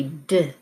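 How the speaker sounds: d as "duh", not "dee"; letter sound. Shown as a demonstration. Teacher gives the new sound, d as "duh". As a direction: say the short sound d makes, "duh". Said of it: "change to a new sound".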